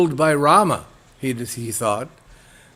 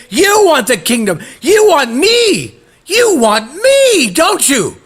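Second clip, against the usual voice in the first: starts raised, climbs to a much higher, louder furious cry; an offended wife losing her temper, louder and higher with every line; building angry outburst; 10 semitones higher, 8 dB louder than usual. Acted. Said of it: "she became extremely angry".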